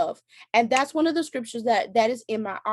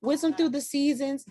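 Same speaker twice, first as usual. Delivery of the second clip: mock whiny despair; 6 semitones higher than usual. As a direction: whine it like you're about to cry, half in jest. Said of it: "And I'm like".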